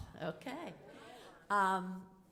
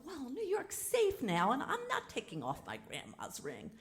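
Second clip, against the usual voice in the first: laughing retort; 8 semitones higher than usual. Retold or imitated. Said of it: "And I go".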